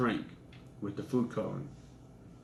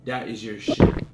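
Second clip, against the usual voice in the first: louder and slow, every word pressed down; firm retold accusation; 8 dB louder than usual. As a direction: hammer it home to shame him. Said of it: "I said".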